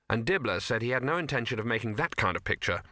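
ordinary delivery, nothing marked

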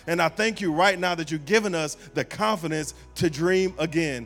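preaching prayer. Acted. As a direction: pray over the room with rising fervor. Leads closing prayer.

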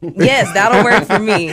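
laughing loudly while agreeing. Real, not acted. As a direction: say it through a real laugh. Laughing at the CSI suggestion.

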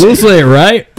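high loud squeal; mock panicked doctor. Friend joins the doctor impression, high and loud. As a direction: chime in as the flustered doctor.

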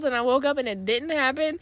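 ordinary delivery

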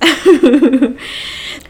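high, bubbly burst of giggles. Real, not acted. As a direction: laugh at your own joke, high and quick. Laughs at own remark.

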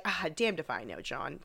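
soft, weary retold voice; mildly tired. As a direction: lightly imitate a worn-out man giving up. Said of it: "So Cragen's tired. He's tired".

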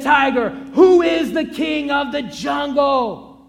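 proud lion bellowing his question; lion's roaring demand. Acted. Lion demands the tiger name the king, booming.

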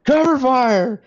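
high, loud shout mimicking her orders; imitated battlefield yell. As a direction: yell it out like she commands the charge. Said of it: "yelling at every mother's son".

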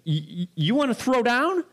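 hammed-up dare to throw down; mock fight challenge. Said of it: "so intense and so irate".

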